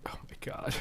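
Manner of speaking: very soft, whispered under the breath; whispered aside. Real, not acted. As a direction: whisper it to yourself while reading. Muttered while scrolling through tweets.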